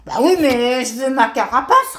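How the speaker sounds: stubborn tortoise insisting. Acted. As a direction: dig in your heels. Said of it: "avec entêtement".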